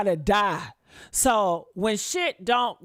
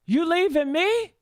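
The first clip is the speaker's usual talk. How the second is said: mock outraged partner; 7 semitones higher than usual. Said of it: "they looking at you as".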